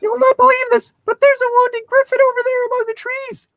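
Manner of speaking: very high, loud squeaky fairy voice. Acted. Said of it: "Navi cried".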